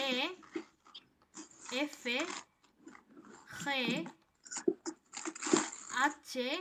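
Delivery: each Spanish letter name said slowly on its own, like আ, বে, ফে, দে; pausing after every letter so the class can follow; slow letter-by-letter recital. Shown as a demonstration. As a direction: recite the alphabet for students to repeat. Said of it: "যেভাবে আমরা pronunciation করছি".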